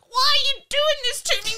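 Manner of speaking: high, loud mock whine; childish whinging. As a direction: whinge like a wronged kid. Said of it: "it's like a child whinging".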